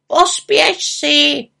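high, loud, drawn-out plea; insistent raven, stretched words; urging. A narrator's reading. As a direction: urge her on as a fretful raven. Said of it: "naléhal Abraxas".

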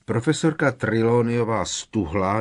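ordinary delivery, nothing marked

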